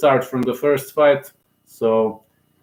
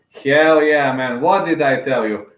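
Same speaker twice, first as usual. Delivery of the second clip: normal talking voice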